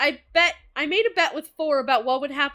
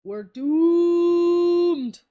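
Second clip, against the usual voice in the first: mock doom; 3 semitones higher, 4 dB louder than usual. Despairing echo of the plan.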